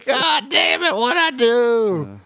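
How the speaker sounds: put-on high, slow, stretched-out shouting drunk; drunk man waking up shouting. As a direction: wail it like a hungover drunk who just realized he's in jail.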